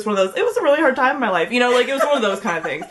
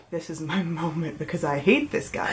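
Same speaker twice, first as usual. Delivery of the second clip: soft, lowered voice with a laugh; hushed aside; 4 semitones lower, 7 dB quieter than usual. Retold or imitated. Retelling her private moment before a fight, hushed aside. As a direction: quietly confide it, as if to yourself. Retold aside to herself, low.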